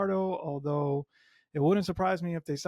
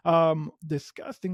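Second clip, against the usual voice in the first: ordinary talk, nothing marked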